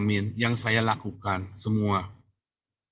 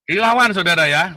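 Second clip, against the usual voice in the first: loud, raised-pitch exhortation; rallying call; 6 semitones higher, 7 dB louder than usual. Real, not acted. Preacher urging followers to fight back legally, rallying call.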